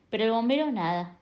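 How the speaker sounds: ordinary delivery, nothing marked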